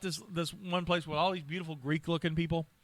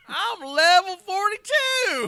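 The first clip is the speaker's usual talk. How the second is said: put-on high, slow, laughing old-timer voice; old Western codger; 11 dB louder, much slower than usual. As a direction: laugh through a hammy prospector voice. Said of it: "What level of Western are you at?".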